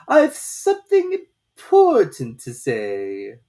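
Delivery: very slow, loud, strange drawn-out voice; slow caterpillar drawl. A narrator's reading. Caterpillar lazily questioning Alice, very slow and loud. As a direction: stretch every word lazily, from on high.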